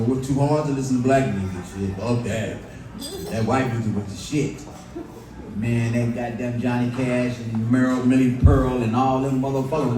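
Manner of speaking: ordinary delivery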